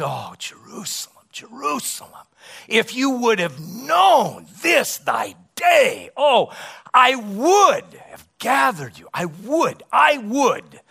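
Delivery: high, loud cry over the city, slowing on the words "if you would have known"; anguished lament. Retold or imitated. Jesus weeping over Jerusalem, crying out in a loud anguished lament. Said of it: "he begins to weep over the city".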